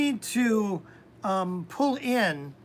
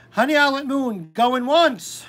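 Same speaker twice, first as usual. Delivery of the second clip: loud, raised calling out; auctioneer call; 5 semitones higher, 9 dB louder than usual. Pretend. Host calling an absent viewer, loud auctioneer call.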